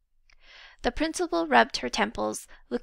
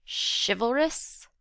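slow, scornful one-word question; dripping with scorn; slower than usual. Acted. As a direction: sneer the word at him like an insult. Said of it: "in a voice dripping with scorn".

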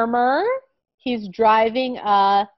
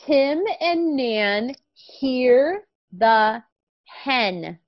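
very slow, clear reading; slow reading aloud; 3 semitones higher, much slower than usual. Shown as a demonstration. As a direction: model the sentence word by word. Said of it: "Let's read our sentence together".

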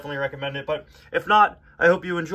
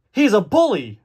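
loud, high storybook accusation; hammed-up character outburst; exclaiming McQueen; 7 semitones higher, 6 dB louder than usual. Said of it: "said McQueen".

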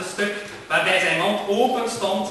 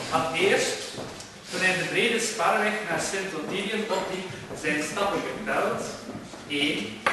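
ordinary delivery, nothing marked

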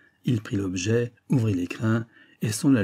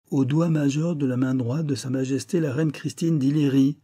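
raised voice, higher and louder, into the phone; gleeful teasing; 6 semitones higher, 4 dB louder than usual. Acted. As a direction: keep the chief guessing with lively relish. Teasing phone call to woken chief. (taquin et triomphant)

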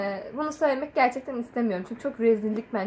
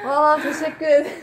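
laughing; 3 semitones higher, 4 dB louder than usual. Real, not acted. Gasping after long breath hold.

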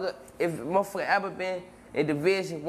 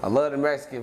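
ordinary delivery

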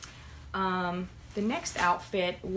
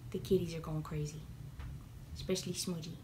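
whispering; 3 semitones lower, 10 dB quieter, faster than usual. Real, not acted. Aside during thunder.

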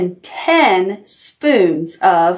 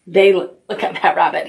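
ordinary delivery

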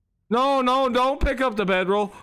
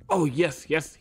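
ordinary talk, nothing marked

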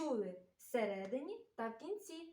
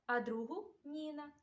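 ordinary delivery

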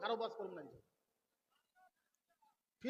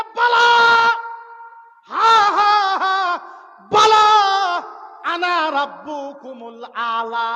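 roaring, much louder and higher king's boast; tyrant's boast; 6 semitones higher, 16 dB louder, much slower than usual. Acted. Pharaoh roars at his daughter that he is the highest lord. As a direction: bellow it like a proud tyrant, last words slow.

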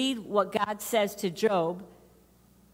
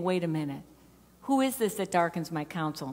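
lower, gentle questioning; fatherly God; 3 semitones lower, faster than usual. Acted. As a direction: talk to him like a father, not a judge. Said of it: "talking to him like a father".

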